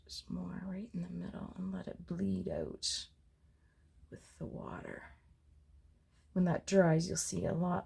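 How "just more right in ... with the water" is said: soft unvoiced whisper. Real, not acted. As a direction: whisper it close to the mic. Painting vlog, whispered commentary.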